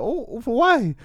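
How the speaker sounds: high, baffled "for why"; baffled retort. Retold or imitated. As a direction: thrown by the threat. Confused retort to threat, acted.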